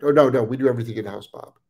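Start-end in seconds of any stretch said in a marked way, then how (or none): none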